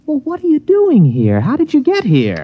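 high, alarmed questions; startled. Acted. Man finds the girl waiting in his rented cabin, startled. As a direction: thrown by finding her there.

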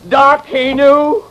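building from slow to high and loud; rising horror, then outrage; dawning outrage. Acted. O'Shea realising to the doctor, building outrage.

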